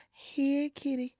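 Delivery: soft, coaxing call to a cat; gentle coaxing. Shown as a demonstration. Showing how to ease out, a gentle coaxing call. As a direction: ease it out gently, like luring a kitty. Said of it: "You being gentle".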